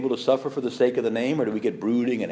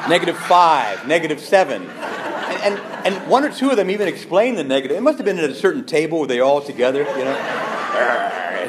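real laughter breaking through louder words; laughing; 4 semitones higher, 5 dB louder than usual. Real, not acted. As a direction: laugh your way through the bad news. Laughing while telling the reviews.

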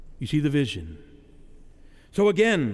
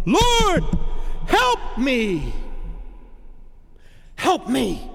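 desperate cry; 8 semitones higher, much slower than usual. Acted. Preacher acting the Canaanite woman, crying out for help. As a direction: call out to God with everything.

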